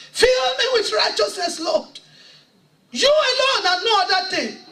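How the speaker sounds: preacher reliving her desperate plea with a sob; tearful prayer. Retold or imitated. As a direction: cry out the remembered prayer. Retold prayer, voice rising in tears.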